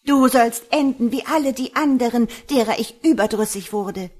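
high, loud enraged threat; loud, furious cursing. A narrator's reading. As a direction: rage at your captive at full voice. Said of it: "Laut fluchend eilte sie davon".

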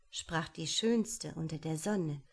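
plain reading voice

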